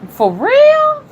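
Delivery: high, loud incredulous question; real surprise. Real, not acted. Reacting to all the vegetable boxes, surprised. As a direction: shoot up high in disbelief.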